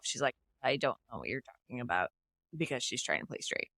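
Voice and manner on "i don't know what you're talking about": quick, low and quiet brush-off; retold casual denial, lower and softer; playing it off. Abby brushes off Riley to seem straight, playing it off. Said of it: "Abby just plays it off".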